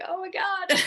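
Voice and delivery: very high mock wail; hammed-up wail breaking into laughter; imitated exasperated boyfriend. Boyfriend's reaction, acted before laughing.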